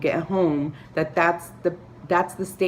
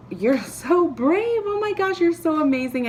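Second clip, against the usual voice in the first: gushing praise; 11 semitones higher than usual. Pretend. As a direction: gush like an awestruck stranger. People gushing at her on street.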